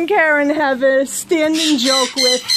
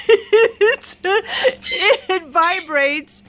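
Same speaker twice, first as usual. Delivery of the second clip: higher words broken up by laughter; giggling; 4 semitones higher, much slower than usual. Real, not acted. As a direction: try to explain while cracking up. Laughing at vibrating gift.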